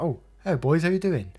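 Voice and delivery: imitated cheery greeting, pitched high; chirpy mum. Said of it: "she actually seemed a bit more chirpy".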